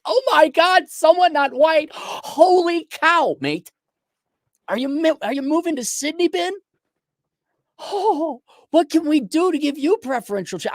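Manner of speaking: mock Australian. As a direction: gush in a squeaky mock Aussie accent. Imitates Australians greeting him.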